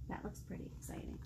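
whisper. Real, not acted. Aside while reading to child.

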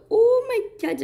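sudden high gasp of surprise; surprised exclamation. Real, not acted. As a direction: blurt it out, amazed. Surprised at seeing a pool. (surpresa repentina, bem aguda)

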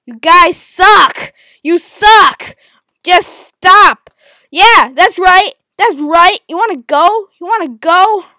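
loud, high, slow taunts; angry shouting. Real, not acted. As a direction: yell a challenge at them. Taunting two attackers.

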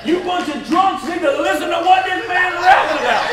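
loud, high, worked-up telling; excited shouting. Real, not acted. Preacher telling the crowd about the drunk, loud and worked up. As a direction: shout it out in disbelief.

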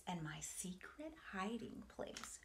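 hushed secret whisper. Acted. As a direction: breathe it like a secret. Secret hiding place.

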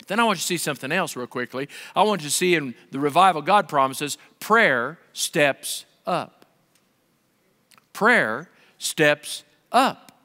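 normal talking voice

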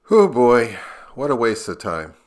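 ordinary talk

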